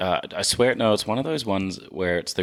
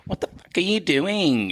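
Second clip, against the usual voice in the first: mock Australian accent; 9 semitones higher than usual. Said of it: "making me feel like it's more Australian".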